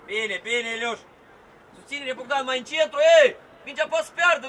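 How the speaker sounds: loud running instructions; coaching shout. Real, not acted. Coach placing players during play, loud shout. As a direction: call directions out across the pitch.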